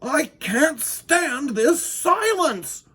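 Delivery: high, slow roar of frustration. Acted. Winky roaring at his silent clock shop. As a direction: bellow it out, drawn long. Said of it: "roared Mister Winky".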